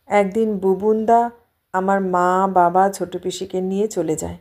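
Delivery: plain reading voice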